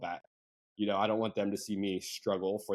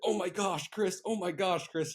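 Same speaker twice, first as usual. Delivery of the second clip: put-on high, excited German-accented squeal of delight; overjoyed German friend shrieking a name, high; 10 semitones higher than usual. German friend thrilled by the news, excited German accent. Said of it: "in a German accent with a voice note".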